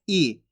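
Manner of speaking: ई kept short and dropped low; a quick short ई, not stretched; short i sound. Shown as a demonstration. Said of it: "इसकी मात्रा को भी इतना ही पढ़ेंगे".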